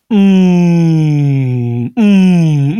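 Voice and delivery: long, loud drawn-out hum, falling away; imitated crowd jeer. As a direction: mimic an exam hall's mass jeer. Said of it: "would just let off the".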